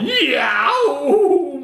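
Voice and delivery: put-on sudden high, loud child's "Yow!"; a hammed-up little boy's yelp; yelp of pain. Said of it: "took a bite and yelled".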